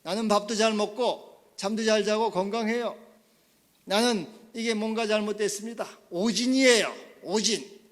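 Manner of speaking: high, loud, insistent acted protest; strong denial. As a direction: insist the diagnosis must be mistaken. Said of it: "강한 부인을".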